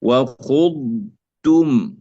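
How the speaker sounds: وخضتم very slowly, ض held before ت; slow وخضتم with long, unmerged ض; lengthened dad. Shown as a demonstration. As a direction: stretch the ض in وخضتم, then a separate ت. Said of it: "مع الاستطالة".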